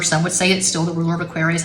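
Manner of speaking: ordinary talk, nothing marked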